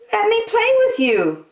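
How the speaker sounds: very high, tiny hamster voice; little hamster voice. A narrator's reading. Little hamster asks Lambkin to play, tiny and high. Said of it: "said little hamster".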